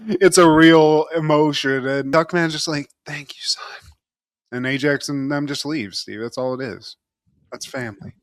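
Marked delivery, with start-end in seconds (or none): 3.1-3.9 retold whispered thanks. Duckman thanks his son quietly.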